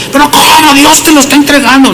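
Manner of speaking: high, hurried disbelief; acted high-pitched objection; incredulous protest. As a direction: protest as a baffled soldier would. Acts out soldier protesting to David.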